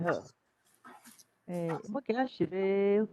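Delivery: ordinary delivery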